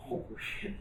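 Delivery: whispered oh shit; a dismayed whisper under the breath; hushed curse. Retold or imitated.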